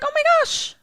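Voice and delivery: very high-pitched squeal of surprise; hammed-up high astonishment; mock amazement. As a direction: squeak it out in exaggerated shock. Retelling own reaction to the release.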